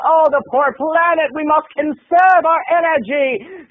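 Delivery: high, slow mock sanctimony; lightly voiced preachy roommate, drawn out; mocking the roommate's high, slow preachy sermon.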